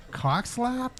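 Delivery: high laughing repeat; laughing disbelief. Real, not acted. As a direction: crack up at the phrase.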